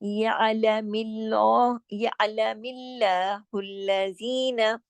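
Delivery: या अलमिल्लाहुल्लजीना slow with thin ल; slow Quranic reading, thin ल; light lam recitation. Shown as a demonstration. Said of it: "हम इसको मोटा नहीं पढ़ेंगे".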